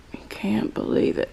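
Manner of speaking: soft whisper to the dog. Real, not acted. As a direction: breathe it in a whisper. Dog indignant, hushed repeat.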